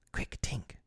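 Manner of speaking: urgent soft whisper. Acted. Peter hurrying Tinker Bell in a whisper. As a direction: hurry her along under your breath. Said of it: "he whispered".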